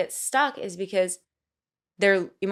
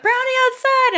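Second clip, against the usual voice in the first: delighted high-pitched brownie cry; mock excited squeal; 9 dB louder than usual.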